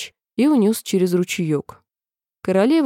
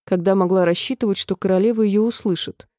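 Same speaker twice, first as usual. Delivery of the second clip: plain reading voice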